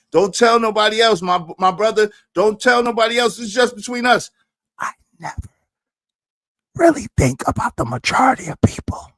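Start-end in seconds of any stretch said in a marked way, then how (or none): 4.8-9.1 breathy secret whisper. Real, not acted. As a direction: whisper it to one friend.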